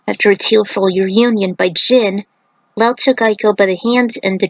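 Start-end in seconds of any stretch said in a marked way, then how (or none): none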